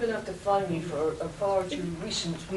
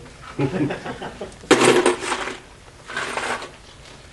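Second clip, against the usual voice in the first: laughter; 3 semitones lower, 5 dB louder than usual. Real, not acted. Laughs at dare.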